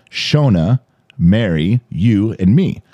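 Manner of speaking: "Shona, Mary, you, and me" as four separate items; slowed down. Retold or imitated. Friend explaining who's in the group text, slowed-down list. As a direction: list each name with a pause after it. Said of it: "So he slows it down".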